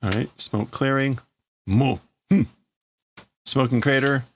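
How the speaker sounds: ordinary talk, nothing marked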